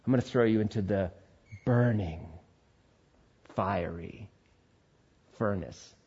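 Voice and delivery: intimidating threat. Acted. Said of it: "when you intimidate somebody".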